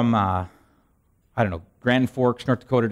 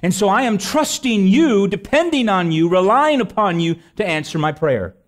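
raised, loud, earnest prayer; fervent prayer; 7 semitones higher, 5 dB louder than usual. Real, not acted. Pastor praying aloud to God over the congregation, fervent and raised. Closing prayer at full force.

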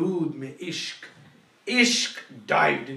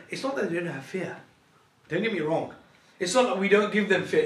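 usual speaking voice

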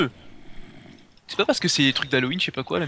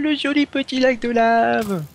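ironique; 9 semitones higher, 5 dB louder, much slower than usual. Acted. Ironie devant la lave.

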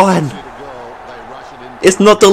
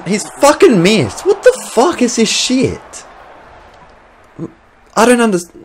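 frustrated disbelief; 6 semitones higher, 3 dB quieter than usual. Real, not acted. Missed goal, frustration.

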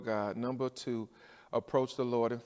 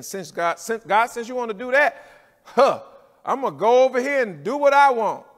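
mock sulky complaint, higher and louder; pouting and mad; 9 semitones higher, 11 dB louder than usual. Believer pouting at God, mock angry whine. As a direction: imitate a sulky believer talking back to God. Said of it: "pouting and mad".

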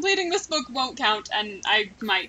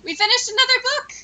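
much higher, louder happy cheer; excited; 11 semitones higher, 5 dB louder than usual. Real, not acted. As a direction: cheer it like a small victory. Celebrating finished book in game.

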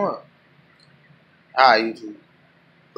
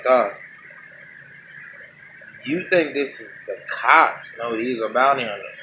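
usual speaking voice

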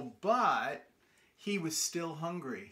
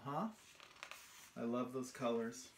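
ordinary delivery, nothing marked

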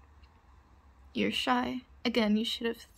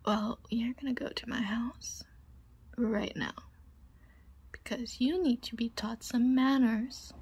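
whispering. Acted.

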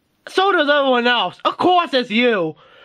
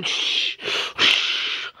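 unvoiced, much softer shush; hushing; 6 dB quieter than usual. Toy hero hushing the others mid-battle, unvoiced shush. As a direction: hush them urgently.

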